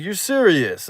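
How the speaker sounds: high-pitched retold disbelief; incredulous. Boy doubting his friend's news, incredulous. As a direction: question it, voice jumping up.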